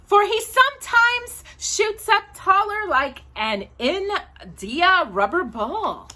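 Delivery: put-on sing-song poem reading for children, lilting; reading a poem aloud. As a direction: read it like a bedtime poem to little kids.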